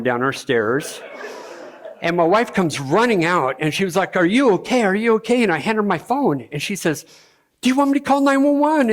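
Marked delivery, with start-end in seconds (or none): 4.1-5.4 higher, louder anxious check; a retold wife's alarmed calling out; worried wife. Wife runs out after his fall. 7.6-8.9 much higher, fast, louder alarm; wife, frightened, offering to call for help; panicked wife. Retold or imitated. Wife panics after his fall.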